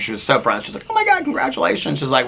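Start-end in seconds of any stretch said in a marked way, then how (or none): 0.9-2.0 high-pitched mock gushing; imitated excited congratulations. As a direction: gush like a friendly stranger, voice way up. Friendly congratulations on anniversary, retold.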